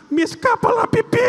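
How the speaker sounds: put-on a giggling girl confessing; girlish giggly voice. Girl laughing tells the priest she needs to pee.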